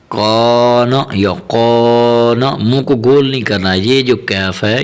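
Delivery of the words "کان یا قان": کان یا قان both said with heavy "qaw"; wrong rounded reading. Shown as a demonstration. Teacher imitating children's mistake, heavy rounded کان یا قان. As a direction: round the mouth, thicken the k in کان. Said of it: "جو غلط پڑھتے ہیں".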